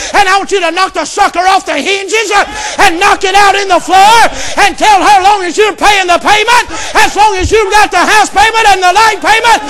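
shouted, high preaching climax; preaching at full force. Acted. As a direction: build to a roaring climax.

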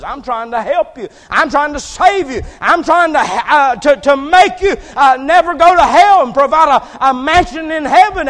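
high, shouted pleading as the Lord; loud, urgent appeal with stumbling repeats; impassioned divine plea. Acted. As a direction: plead at full preaching volume to save someone. Acts the Lord pleading with Paul.

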